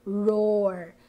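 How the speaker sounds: "Roar" drawn out low, rolling the r; roar sound. Shown as a demonstration. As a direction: say "Roar" like a growl. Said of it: "yung word na roar". ("Roar" na mabagal at pababa)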